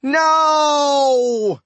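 high, loud drawn-out cry; stretched howl of protest; long yelled no. A narrator's reading. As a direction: wail it out in one long falling yell. Captive villain cries out as attacked.

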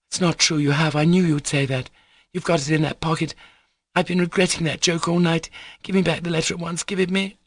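high-pitched, rapid demand; petulant girl insisting; insistent young girl. Acted. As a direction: contradict him, mounting impatience. Lisa demands the letter.

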